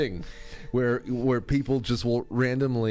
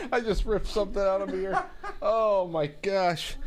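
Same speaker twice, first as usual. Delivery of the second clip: laughing; 9 semitones higher than usual. Real, not acted. Laughing fit after joke.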